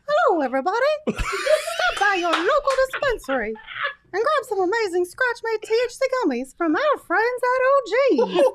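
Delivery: high, giggly cartoon mouse ad read; Mickey Mouse. Host reading gummies ad for roulette, high mouse voice. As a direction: read the ad in a badly faked mouse falsetto. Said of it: "does anybody know a Mickey Mouse voice".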